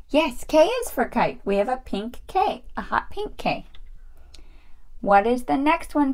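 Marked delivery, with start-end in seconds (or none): none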